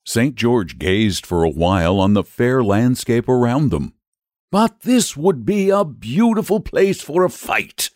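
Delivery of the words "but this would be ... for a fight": high, eager coaxing; persuasive. A narrator's reading. Saint George coaxes the dragon toward a fight, persuasively. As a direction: sell the idea in a much raised pitch. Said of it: "he began again persuasively".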